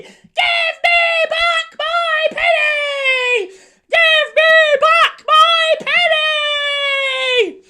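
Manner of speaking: high, slow, shrieking cockerel cry; angry cockerel. Acted. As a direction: crow it out furious at the sultan. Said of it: "Lift up your wing and say".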